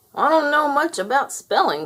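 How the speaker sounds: a lightly voiced dog. A narrator's reading. Dog speaking.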